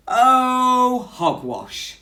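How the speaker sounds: high, loud dismissive oh, then hogwash; loud blustering scold; scolding bear. Acted. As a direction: scold the mouse's foolish idea. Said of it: "Bear scolded".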